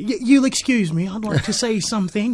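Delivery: put-on a light Australian accent quoting the PM; imitating the prime minister's Australian accent. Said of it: "He said it in the Aussie accent".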